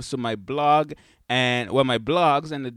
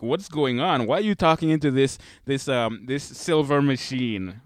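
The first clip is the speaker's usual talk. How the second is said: ordinary talk, nothing marked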